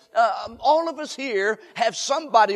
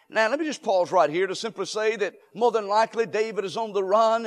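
ordinary delivery

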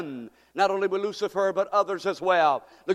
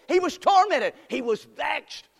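preaching cry; 7 semitones higher than usual. Acted. Preacher crying out tormented to the church.

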